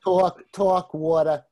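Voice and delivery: talk, talk, water in a New York accent. Shown as a demonstration. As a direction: show off a thick New York accent with a grin. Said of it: "I have a New York accent".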